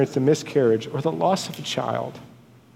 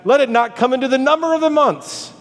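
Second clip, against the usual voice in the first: high, ringing declamation; poetic curse; 10 semitones higher than usual. Acted. Preacher reciting Job's poetic curse, high and impassioned. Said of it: "this is how he says it in verse six poetically".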